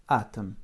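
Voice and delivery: "Atome" with a strong first A and barely sounded O; stress on first A. Shown as a demonstration. Teacher showing where the accent falls, first-syllable stress on "Atome". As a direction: hit the A of "Atome", let the rest fall away. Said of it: "l'accent tombe sur le A".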